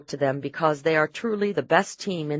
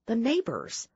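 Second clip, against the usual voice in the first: hushed warning; 7 semitones higher, 5 dB quieter than usual. A narrator's reading. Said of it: "she warned him".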